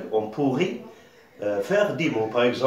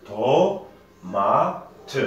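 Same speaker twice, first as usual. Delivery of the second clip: "To", "ma", "te" stretched with gaps between; syllable by syllable. Shown as a demonstration. Teacher recounting syllables for pupils, "To-ma-te" split syllable by syllable. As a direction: sound out TO-MA-TE, final "te" fully voiced. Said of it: "Nous avons trois syllabes".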